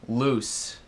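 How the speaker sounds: loose ending on a hissed voiceless S; final S sound. Shown as a demonstration. Teacher contrasting loose and lose, loose with a hissed S. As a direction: loose said like "luss". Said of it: "Então, loose".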